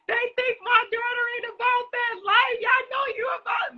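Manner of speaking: high, loud, sassy mom voice; mama defending her daughter; high-pitched sassy mom impression; imitating mom. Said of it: "She was like".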